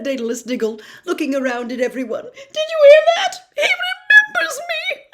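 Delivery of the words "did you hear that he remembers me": very high and loud, laughing through happy sobs; tearful joy. Acted. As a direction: overcome with happy tears. Diggle overcome with joy.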